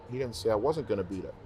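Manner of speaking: ordinary delivery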